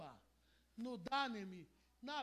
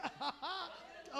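laugh; 5 semitones higher than usual. Real, not acted. Laughs at own joke.

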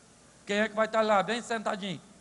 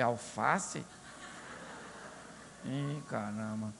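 deadpan surprise; 11 semitones lower, 11 dB quieter, much slower than usual. Retold or imitated. Pastor surprised at lettuce.

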